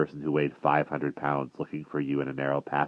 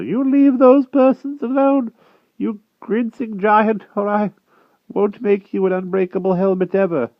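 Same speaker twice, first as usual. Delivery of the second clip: put-on old prisoner scolding the grinning giant; feeble old inventor; 8 dB louder than usual. A narrator's reading. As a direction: rattle out the threat indignantly.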